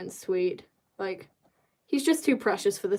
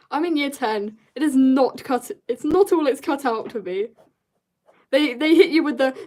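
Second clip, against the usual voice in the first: ordinary delivery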